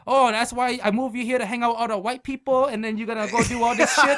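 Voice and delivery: loud, high-pitched ranting father; scolding dad. Retold or imitated. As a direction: rant like an exasperated parent. Voices dad scolding him.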